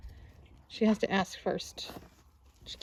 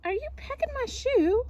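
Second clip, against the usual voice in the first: high coo to a hen; 13 semitones higher than usual. Real, not acted. Talking to a hen.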